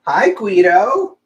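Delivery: high, slow, drawn-out hello to a lizard; pet talk. Real, not acted. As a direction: coo it like greeting a pet.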